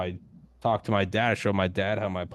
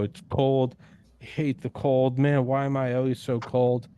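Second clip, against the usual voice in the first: higher, mocking whine about the cold; whiny complaining; 3 semitones higher than usual. Mocking a weak man sitting in the cold, whiny. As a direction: whine like someone who just sits in the cold.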